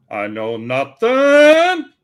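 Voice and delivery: high, slow Sergeant Schultz voice; drawn-out German sergeant denial. Retold or imitated.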